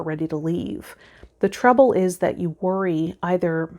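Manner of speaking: ordinary talk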